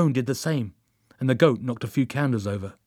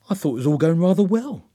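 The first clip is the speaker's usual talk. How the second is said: higher, louder puzzled protest; puzzled disappointment; 6 semitones higher, 5 dB louder than usual. A narrator's reading. Joan laments the failed ritual, puzzled disappointment. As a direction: lift the voice in mild dismay. Joan voiced, let down by failure.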